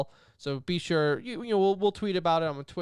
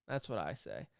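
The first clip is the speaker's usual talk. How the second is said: mock quiet echo; 11 semitones lower, 11 dB quieter than usual. Shown as a demonstration. Repeats catchphrase in two deliveries.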